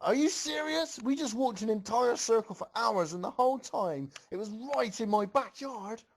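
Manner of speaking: high, strained furious rant; yelling in rage. A narrator's reading. Rainbow Dash raging at the group, high furious yell. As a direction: blow up at the whole group. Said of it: "yelled to the group in rage".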